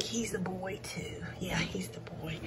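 quiet unvoiced whisper. Real, not acted. Checking a pup's sex, hushed whisper. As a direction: hush it like they're sleeping. Whispering over newborn puppies.

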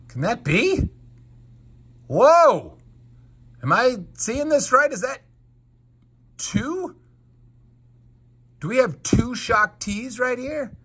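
can't believe his eyes; stunned collector finding a rare double; astonished. Real, not acted. Spotting an unexpected second figure.

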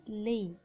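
"لي" said with AI as one long "ay"; French "لي" ending in an open e; vowel as long ay. Shown as a demonstration. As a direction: say "لي" like French "lait", lay. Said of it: "بننطقه مثل الألف المكسورة".

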